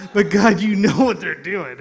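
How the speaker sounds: protesting. Retold or imitated. Complaining to God about mistreatment, loud protest.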